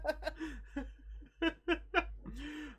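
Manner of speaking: high, real burst of laughter; laughing hard at the table. Real, not acted. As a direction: let a high laugh escape. GM laughs at the joke.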